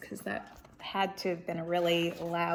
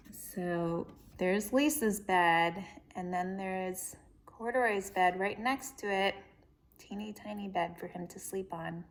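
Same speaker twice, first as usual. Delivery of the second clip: ordinary delivery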